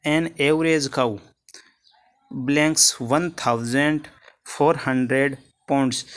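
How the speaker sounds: very slow, word by word; reading the question. Shown as a demonstration. Teacher reads the exam sentence slowly for students. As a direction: dictate the question slowly to the class. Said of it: "next question है".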